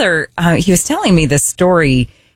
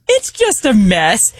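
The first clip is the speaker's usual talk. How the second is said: ordinary delivery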